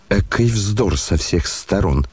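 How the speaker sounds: usual speaking voice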